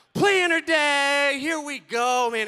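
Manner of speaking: loud, slow, thrilled cheer; a wife overjoyed at her new planner; excited wife. Retold or imitated. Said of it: "and it is just like".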